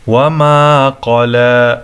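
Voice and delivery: slow recitation with long "aa" in وما and قلى; وما قلى chanted with held endings; one-alif stretch. Shown as a demonstration. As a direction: draw out the vowels of وما قلى. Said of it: "یہ بھی ایک الف کے برابر کھینچنا ہوگا".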